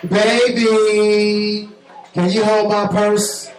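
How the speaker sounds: imitating a girlfriend's drawn-out, high-pitched plea; wheedling girlfriend. Girlfriend with full hands asking her man, wheedling. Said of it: "This is what they do is".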